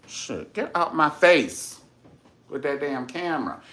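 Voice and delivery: mock annoyed. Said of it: "Tina Landon was like".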